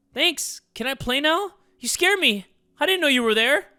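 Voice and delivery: put-on young boy, jumpy and eager; high kid voice. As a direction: sound like a startled little kid. Plays the kid character.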